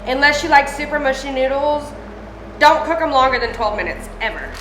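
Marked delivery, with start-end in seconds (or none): none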